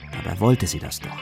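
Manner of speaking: ordinary talk, nothing marked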